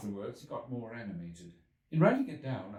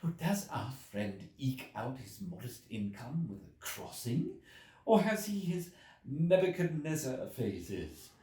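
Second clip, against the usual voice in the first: slow, mocking voice of the curious editor; an eager, teasing editor; fervent editor; 5 semitones higher, slower than usual. A narrator's reading. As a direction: needle him with fervent curiosity. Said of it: "the editor got fervent in his curiosity".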